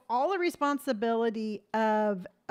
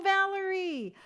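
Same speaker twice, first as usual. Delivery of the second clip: normal talking voice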